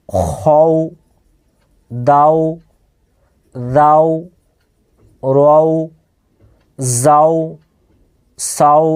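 isolated syllables, each held evenly and set apart; pronunciation drill. Shown as a demonstration. Teacher pronounces each syllable alone for learners, even drill. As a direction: recite one syllable at a time with long pauses. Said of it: "এবারে উচ্চারণটা শুধু বলছি".

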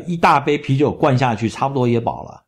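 ordinary talk

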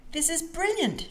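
high-pitched excited exclamation; gleeful little storybook character; thrilled. A narrator's reading. Molly thrilled by plane ride.

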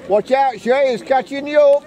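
loud high warning call; yelling from the sidelines. Real, not acted. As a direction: call out across the pitch.

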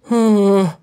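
ordinary talk

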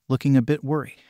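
usual speaking voice

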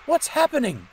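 calling out in sudden worry; startled engine crying out; alarmed cry. A narrator's reading. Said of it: "he cried".

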